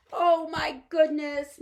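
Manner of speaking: high, loud, slow moan; Homily moaning about the journey. Acted. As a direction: groan it out in dismay. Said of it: "moaned Homily".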